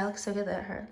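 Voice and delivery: soft whisper to herself. Real, not acted. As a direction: whisper it in self-admiration. Admiring herself on camera.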